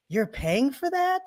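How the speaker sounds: can't believe anyone would pay; incredulous friend. Retold or imitated. Friend at the front desk, mocking disbelief.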